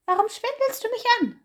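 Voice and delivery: high, loud little hare crying out; indignant cry. Acted. Hase accuses Bär of lying, a high loud cry. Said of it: "rief er".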